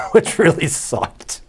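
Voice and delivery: laughing through the words; chuckling while talking. Real, not acted. Laughing about losing early contest.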